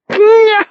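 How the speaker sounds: loud high hearty laugh; big hearty laugh. Acted. Barmaid laughs at her own joke to Wynne, big hearty laugh. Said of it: "with a big hearty laugh".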